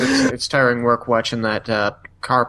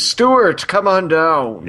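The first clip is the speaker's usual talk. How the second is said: mock game-show call, higher and louder at first; game show announcer; 4 semitones higher, 3 dB louder, much slower than usual. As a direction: call a contestant down with drawn-out words. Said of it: "walking onto a game show".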